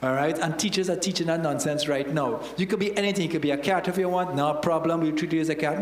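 ordinary talk, nothing marked

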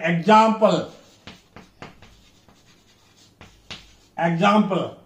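example with AM stressed; second-syllable stress. Shown as a demonstration. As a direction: demonstrate example as ex-AM-ple. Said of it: "X sound in second".

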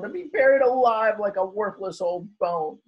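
high, loud groaning crab voice. A narrator's reading. Crab dropped in the hole groaning at his fate. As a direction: moan it as if all is lost. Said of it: "Kermit said with a groan".

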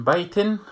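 بیتن said as bayt-in, with the kasra and n; teacher calling out بیتن with kasra tanween; tanween kasra demo. Shown as a demonstration.

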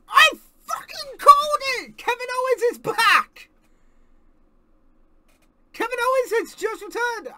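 loud high triumphant shout; excited yell. Real, not acted. Fan celebrating a wrestler's return on stream, triumphant yell. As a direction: yell it like you predicted it.

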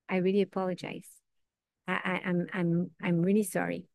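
soft, broken, stammering sorry; hushed apology. Acted. The penitent apologising to the people they hurt, hushed and halting. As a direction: murmur the apology, barely voiced.

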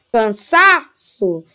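Cansaço drawn out slowly on SA; stress on SA. Shown as a demonstration. Teacher showing the penultimate is strong, Cansaço stretched on SA. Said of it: "é a penúltima sílaba é forte".

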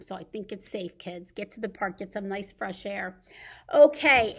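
ordinary talk

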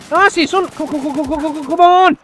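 high, loud eager urging; real excitement in the firefight; excited shout. Real, not acted. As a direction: cheer yourself on as you spot him. Spots enemy, urges himself on.

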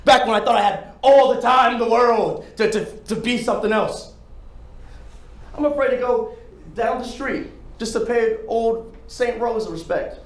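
high, loud desperate yelling; anguished shouting. Acted. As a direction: shout out the confession in despair. Audition monologue, shouted confession.

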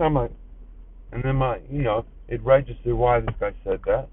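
normal talking voice

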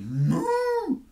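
long, high, loud moo; drawn-out cow's lowing. A narrator's reading. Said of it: "And the cow said".

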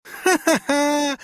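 very high villainous "ha ha"; piercing, scornful laugh; mocking cackle. A narrator's reading. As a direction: cackle in gloating triumph. Governess laughs in triumph.

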